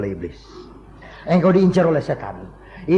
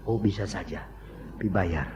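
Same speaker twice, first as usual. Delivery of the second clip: low, soft offhand reply; mock casual assurance; 10 semitones lower, 10 dB quieter than usual. Medium agrees to mother's plea for a fee, low and casual. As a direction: imitate an unbothered medium, quietly.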